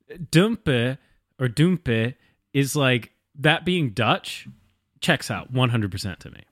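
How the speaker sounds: normal talking voice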